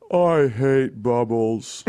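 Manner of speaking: slow, flat gloomy mock voice; mopey buzzkill. Mocking the Cuomo brothers as gloomy buzzkills in a hot tub. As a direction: drag it out like a sulking killjoy. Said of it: "they're just like".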